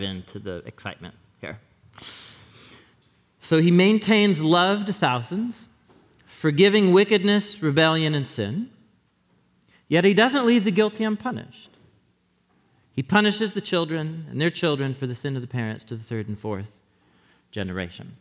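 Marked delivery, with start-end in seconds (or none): 3.4-15.1 scripture reading. Acted. Reading the verse aloud.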